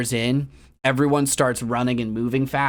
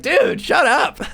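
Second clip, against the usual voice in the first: annoyed retort; 12 semitones higher, 5 dB louder, slower than usual. Retold or imitated. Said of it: "Like, just like".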